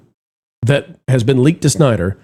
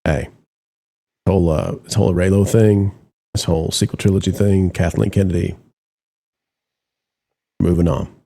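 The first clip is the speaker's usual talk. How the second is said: low, quiet confidential aside; low conspiratorial mutter to a colleague; hushed aside; 8 semitones lower, 4 dB quieter than usual. Retold or imitated. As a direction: lean in and murmur like a boss sharing a secret. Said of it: "pulled him over and said".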